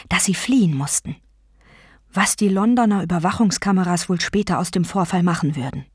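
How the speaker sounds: plain reading voice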